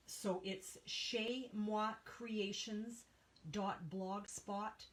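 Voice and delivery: slow, careful chez moi creations; spelling out French name. Shown as a demonstration. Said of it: "it's French".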